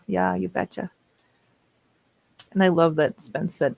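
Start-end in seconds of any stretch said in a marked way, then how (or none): 0.1-0.9 put-on Minnesota accent. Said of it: "It's that whole Minnesota thing".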